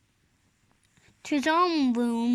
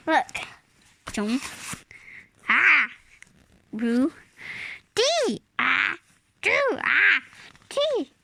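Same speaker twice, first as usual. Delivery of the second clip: sneeze building; 3 dB louder than usual. Acted. Snowman sneezing mid-word.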